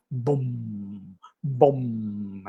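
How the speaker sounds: soft, low imitated gong; low drum boom. Shown as a demonstration. Lecturer imitating soothing drum or gong, low and soft. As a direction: let each boom resound low and soft. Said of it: "low pitch, like the drum or the gong".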